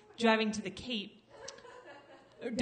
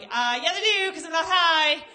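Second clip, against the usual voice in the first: loud, higher, slowed-down protest; defensive insist; 7 semitones higher, 10 dB louder, slower than usual. Retold or imitated. Teen driver arguing back to the cop, loud insistence. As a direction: insist loudly, a bit desperate. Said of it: "I'm like".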